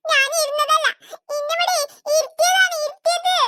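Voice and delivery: acted sobbing whine; tearful complaint. Hurt child answering why she is on the floor, tearful. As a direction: protest through little sobs.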